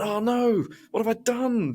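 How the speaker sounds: high, mock panicked cry. After quitting on the phone, mock panic. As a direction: wail in mock dismay at your own rash move. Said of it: "And I went".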